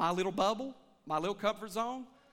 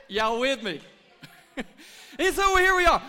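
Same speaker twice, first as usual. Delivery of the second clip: laughing; 4 semitones higher, 10 dB louder than usual. Real, not acted. Laughing with congregation.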